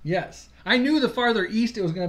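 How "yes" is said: normal talking voice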